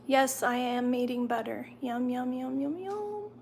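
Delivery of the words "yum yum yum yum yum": sing-song. Real, not acted. Eating butter, sing-song.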